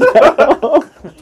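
big laugh, pitched high; cracking up at the ending; real laugh, high and loud. Real, not acted. Laughing at story end.

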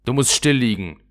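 a hint of a rough little robber girl showing off. Räubermädchen droht Gerda. (leicht gespieltes wildes Räubermädchen, prahlend)

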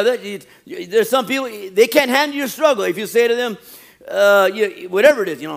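ordinary talk, nothing marked

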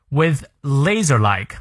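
with laser-like slowed, laser said clearly; teacher drilling laser-like; slow pron demo. Shown as a demonstration. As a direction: model laser-like slowly. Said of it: "拉字的音".

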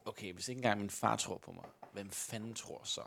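low, quiet, deflated musing; dejected inner thought. Acted. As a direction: sink into quiet self-doubt. Player's dejected inner thought voiced.

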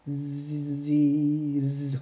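quiet snoring sound; soft snore. A narrator's reading. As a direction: snore gently.

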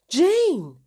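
high, loud exclamation of the name; sharp protest. A narrator's reading. Paula protests being called a liar, indignant. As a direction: cry out her name in reproach.

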